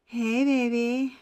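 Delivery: hammed-up slow flirting; sex demon. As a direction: imitate a seductive demon, very slowly. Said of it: "like, a fucking sex demon".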